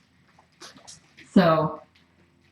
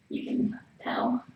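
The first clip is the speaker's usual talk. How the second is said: whisper; 4 semitones higher, 7 dB quieter, slower than usual. Real, not acted. Whispering beside the sick cat.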